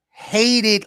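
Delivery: "Hated" punched high and loud; emphatic. Real, not acted. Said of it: "say that for emphasis".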